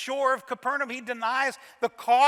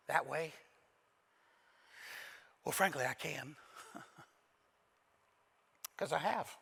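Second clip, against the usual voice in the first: quiet sincere confession; 6 semitones lower, 7 dB quieter than usual. Real, not acted. Preacher to the congregation, quiet sincere reflection. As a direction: confess it gently, humbly.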